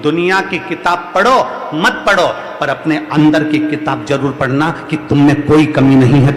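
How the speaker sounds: loud, rallying exhortation; forceful motivational preaching. Acted. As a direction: preach it loud to rouse the crowd.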